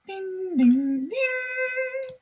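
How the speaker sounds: high sung ding ding chime; chime imitation. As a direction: sing it like a train chime. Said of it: "a chime that goes".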